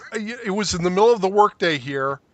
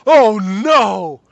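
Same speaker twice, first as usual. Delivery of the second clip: loud, high "oh no!"; shocked outcry; 4 semitones higher, 9 dB louder than usual. Retold or imitated. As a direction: cry out in alarm. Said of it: "I was like".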